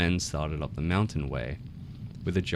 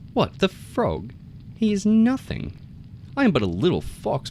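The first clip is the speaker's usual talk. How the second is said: scoffing; 12 semitones higher than usual. A narrator's reading. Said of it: "The fox laughed at him".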